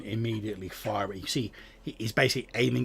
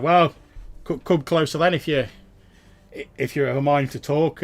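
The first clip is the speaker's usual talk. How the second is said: higher, louder sly farmer sizing up strangers; cagey farmer, raised voice; wary farmer; 5 semitones higher, 11 dB louder than usual. Acted. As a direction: draw it out like a suspicious old farmer.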